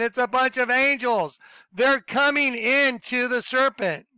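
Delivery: loud, high, urgent preaching; fired-up revival preacher pointing things out; preaching at full force. Acted. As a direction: drive every reveal home to the crowd. Shows the sheep on the map.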